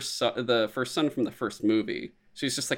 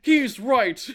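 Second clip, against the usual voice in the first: much higher, mock-impressed murmur; hammed-up British agreement; awed British crowd; 12 semitones higher, 3 dB louder than usual. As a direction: concede like a won-over crowd. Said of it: "the British are like".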